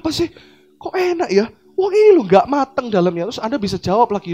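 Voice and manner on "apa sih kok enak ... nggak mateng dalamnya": high, questioning kok enak ya; puzzled taster. Pretend. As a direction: doubt the taste out loud. Friend tasting, puzzled complaint.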